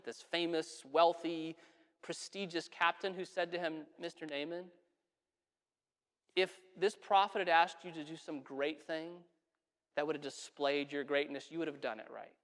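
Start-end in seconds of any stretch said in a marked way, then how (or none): 4.0-12.3 soft, low-voiced friend; hushed, lower counsel; gentle reasoning. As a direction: talk a proud man down quietly. Friend gently reasons with angry captain.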